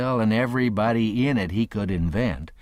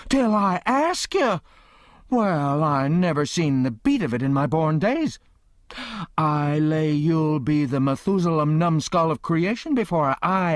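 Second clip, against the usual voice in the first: outraged aunt; 7 semitones higher than usual. A narrator's reading. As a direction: scoff in disbelief, then rant.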